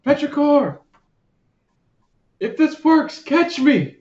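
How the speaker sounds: high, pleading call; character voice. Acted. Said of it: "He says".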